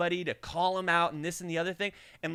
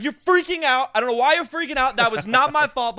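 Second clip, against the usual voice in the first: loud, higher and fast; agitated driver; 6 semitones higher, 8 dB louder than usual. Retold or imitated. Driver scolding the narrator, loud and fast. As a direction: fire it off, rattled. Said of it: "He's like".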